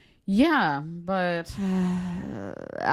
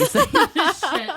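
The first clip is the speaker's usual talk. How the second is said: laughing; 7 dB louder than usual. Real, not acted. Self-mocking, cracking up mid-sentence.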